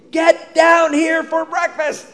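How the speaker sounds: mom calling. Retold or imitated. Mom calling the kids down for breakfast, loud.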